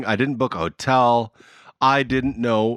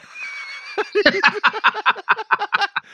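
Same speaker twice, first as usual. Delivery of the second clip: long high-pitched laughter. Real, not acted. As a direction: laugh hard at the memory. Laughs at own story.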